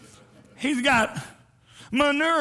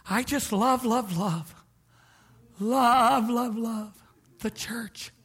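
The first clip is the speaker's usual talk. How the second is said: mocking churchgoer; slower than usual. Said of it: "Have you heard this in the church?".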